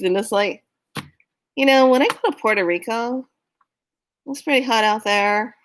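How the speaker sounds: ordinary talk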